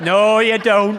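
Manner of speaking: high, loud, laughing stomach voice; the stomach flatly forbids it, cracking up; stomach refusing. Retold or imitated. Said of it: "my stomach was going".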